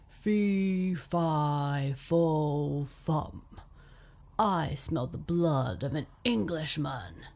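loud booming giant roar. Acted. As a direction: bellow each word like a giant. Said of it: "roared the giant".

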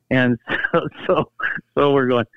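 ordinary talk, nothing marked

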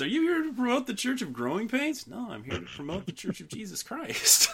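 high-pitched comic skit voices; hammed-up question and answer parody; Family Guy bit. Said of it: "Classic Family Guy".

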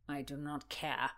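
low, soft, sulky answer; sullen refusal. A narrator's reading. Rutland refusing Constance's distraction, sullen.

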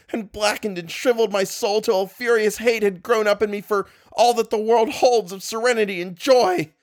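high, strained and rising toward a scream; rising shrill voice. Acted. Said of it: "voice had risen to a shrill scream".

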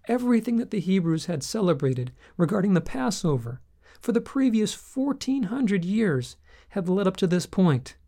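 ordinary delivery

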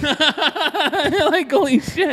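high laughing holy shit; laughing disbelief. Real, not acted. Tech laughing to friends that he got his phone in, laughing disbelief.

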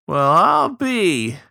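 high, loud, drawn-out delight; grinning surprise. A narrator's reading. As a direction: draw it out with a grin, high and loud. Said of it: "Early grinned".

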